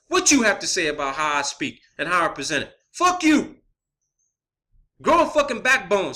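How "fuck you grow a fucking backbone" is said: higher-pitched, defiant outburst; angry retort. Real, not acted. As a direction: throw it angrily at your critics. Defiant retort to his critics.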